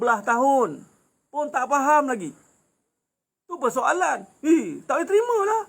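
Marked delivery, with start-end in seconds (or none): 4.4-5.6 much higher, whiny indignant wife. Retold or imitated. Preacher imitating a first wife refusing her husband's new marriage, high and whiny. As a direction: squeal it like a wife who won't accept it.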